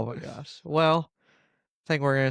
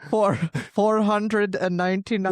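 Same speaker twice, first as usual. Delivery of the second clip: put-on higher Indian-accented call center voice; Indian telemarketer accent; 6 semitones higher than usual. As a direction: play an Indian phone scammer. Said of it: "Indian telemarketer".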